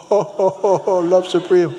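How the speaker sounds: loud, high mock exclamation; delighted-sounding reaction. Jamaican man hears the incense's name, loud high reaction.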